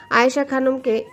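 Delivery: usual speaking voice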